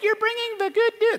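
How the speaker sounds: very high sing-song greeting; mock cheerful welcome. Sarcastic cheerful greeting Rahab didn't give, very high. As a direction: ham up a welcome that never came.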